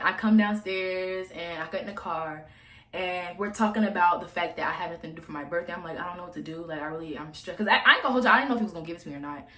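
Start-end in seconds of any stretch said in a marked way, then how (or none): 6.0-7.6 soft, put-on stressed voice; fake stressed. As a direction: play the upset broke student, quietly. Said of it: "I was talking like I was stressed".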